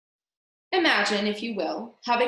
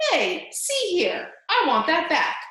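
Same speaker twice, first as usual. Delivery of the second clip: higher and louder, slowly; indignant protest; 8 semitones higher, 5 dB louder, slower than usual. Acted. Prisoner protesting to the rat, indignant and loud. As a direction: sputter in protest as the cloth is pulled away.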